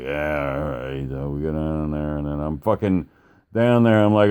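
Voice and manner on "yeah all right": low, quiet imitation of giving in; reluctant agreement. Billy agreeing to one more run, reluctantly. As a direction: mumble a resigned yes, soft and low.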